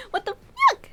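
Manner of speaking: shocked outrage. Real, not acted. Player reacting to Kroven, shocked high squeak. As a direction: gasp it in disbelief.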